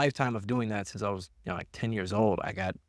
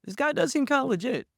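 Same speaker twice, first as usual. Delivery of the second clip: high, loud surprised realization; 3 dB louder than usual. Retold or imitated. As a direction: sound genuinely impressed. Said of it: "I was like".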